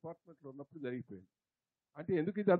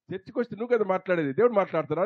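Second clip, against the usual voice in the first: indignant retort; 10 dB louder than usual. Retold or imitated. Challenging the pastor in retelling.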